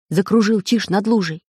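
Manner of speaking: ordinary delivery, nothing marked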